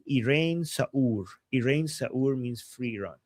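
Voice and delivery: normal talking voice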